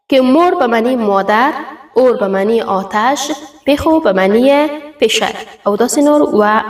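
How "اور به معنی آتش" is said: ordinary delivery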